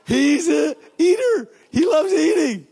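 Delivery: bursting with joyful wonder; excited exclaim. Real, not acted. Preacher exults about Jesus eating.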